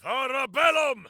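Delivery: high, booming spell chant; shouted incantation. Acted. Warrior casting a spell mid-fight, shouting the incantation. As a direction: hurl the Latin words like a battle cry.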